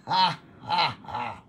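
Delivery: declared ha-ha-ha; laughing at illness. Shown as a demonstration. Preacher laughing at cancer by faith, deliberate laugh. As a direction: laugh on purpose at the disease. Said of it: "Tawanan ninyo".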